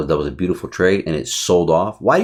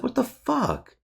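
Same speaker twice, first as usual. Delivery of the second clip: much higher and softer, a stunned what the fuck; stunned disbelief; 7 dB quieter, slower than usual. Retold or imitated. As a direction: gasp it out in sudden disbelief. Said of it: "I was like".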